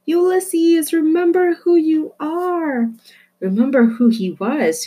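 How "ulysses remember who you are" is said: high, loud, slow urging; urgent call. Acted. Flora urgently calls to Ulysses, high, loud and slow. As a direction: urge him loudly to remember. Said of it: "she shouted".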